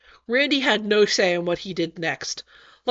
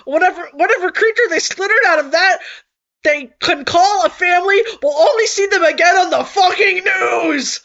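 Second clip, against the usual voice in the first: upset shout; 7 semitones higher, 4 dB louder than usual. Acted. Upset rant, crying shout.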